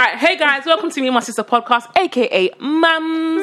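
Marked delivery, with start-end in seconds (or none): 2.0-3.4 drawn-out tag line. Acted. Podcast intro sign-off flourish.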